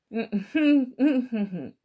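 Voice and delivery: loud laugh breaking out; big laugh; burst of laughter. Acted. Mats caught biting the cheese.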